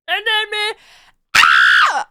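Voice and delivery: sudden high shout then scream; shouted outburst. Real, not acted. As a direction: yell it out wildly. Shouts the song's end.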